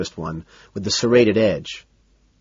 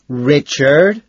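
high, louder call to a dog; raised, questioning reproof; mild scolding; 5 semitones higher, 7 dB louder than usual. A narrator's reading. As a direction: call the dog's name in rising reproach. Owner scolding his growling dog.

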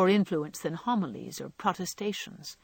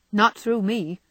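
decidedly; 3 semitones higher, 6 dB louder than usual. A narrator's reading. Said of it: "said Jo decidedly".

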